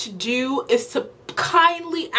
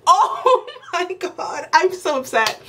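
laughing disbelief; 6 semitones higher than usual. Real, not acted.

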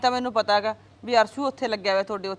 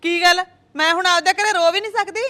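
hurt protest; 5 semitones higher, 5 dB louder than usual. Acted. Mother quarrels with daughter-in-law.

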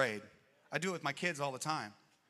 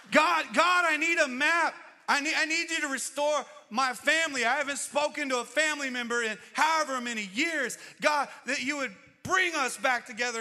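loud, very high begging cry; desperate prayer; 12 semitones higher, 10 dB louder than usual. Retold or imitated. Preacher voicing a desperate plea to God for family, loud and high. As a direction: plead at the top of your voice.

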